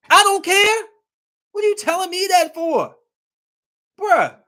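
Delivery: high, loud outburst of disbelief; outraged shout. Acted. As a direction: yell it like you can't believe they said that. Outraged reaction to women's claim.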